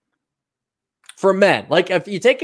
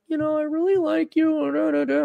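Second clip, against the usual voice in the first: much higher, put-on woman's voice; mock girly let-down; 12 semitones higher, 3 dB quieter than usual. Gal breaking it off with the short guy, mock high voice. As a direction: pitch it way up like a girl gently dumping someone. Voicing gals rejecting him.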